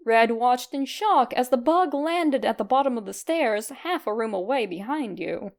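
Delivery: ordinary delivery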